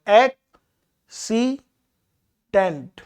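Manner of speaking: accident broken into three slow syllables, ac-ci-dent; accident, spaced out for counting syllables. Shown as a demonstration. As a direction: say accident slowly with a gap after each syllable. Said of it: "syllables, अगर हम इसके देखें".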